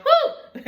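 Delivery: high, loud delighted whoop; a sudden whoop of disbelief; amazed whoop. Real, not acted. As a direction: let out a shocked laughing whoop. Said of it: "I said".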